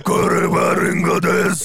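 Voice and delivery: slow smoky Ryuk voice; smoker's rasp. Acted. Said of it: "прокуренным голосом". (прокуренный голос)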